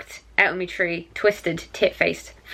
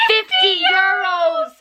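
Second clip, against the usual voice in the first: high, slow, loud squeal of disbelief; excited shriek; 13 semitones higher, 4 dB louder, slower than usual. Real, not acted. Friend shrieking at a scratch card win, excited. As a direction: shriek it like you just won.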